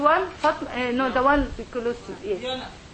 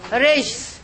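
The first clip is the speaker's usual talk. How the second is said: usual speaking voice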